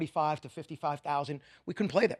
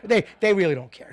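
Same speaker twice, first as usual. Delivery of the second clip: pushed up, louder and higher on the repeat; a rising laughing insistence; emphatic louder repeat; 4 semitones higher, 8 dB louder than usual. Real, not acted. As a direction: hammer the point home the third time. Repeating the point, building.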